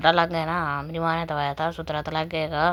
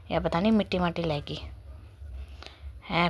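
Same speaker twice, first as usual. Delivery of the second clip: whisper; 4 dB quieter than usual. Real, not acted. Aside about dirt on cap.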